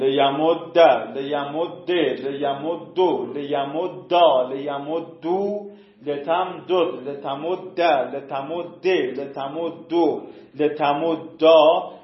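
لیم and لتم forms chanted slowly one by one; slow classroom recitation; slow paradigm recital. Shown as a demonstration. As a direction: drill the list slowly for students. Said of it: "شد لیم دود".

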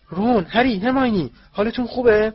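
high, loud, frantic concern; alarmed calling. A narrator's reading. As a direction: call out to each child in panic. Frightened father rushes to the children. (فریاد نگران پدرانه)

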